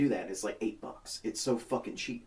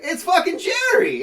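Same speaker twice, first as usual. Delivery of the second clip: high, loud, drawn-out cry of recognition; excited shout; 10 dB louder, slower than usual. Retold or imitated. Telling the cop the milk guy just walked in, thrilled. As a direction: shout it like spotting a celebrity.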